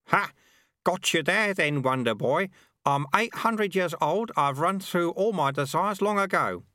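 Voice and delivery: put-on high, gleeful old man's crow; teasing old sweeper. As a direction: gloat playfully. Lutze teasing.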